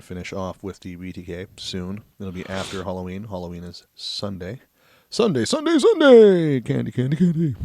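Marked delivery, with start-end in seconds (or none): 5.1-7.5 booming, high monster truck announcer. Pretend. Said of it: "a pseudo monster truck guy and a pseudo stripper D J".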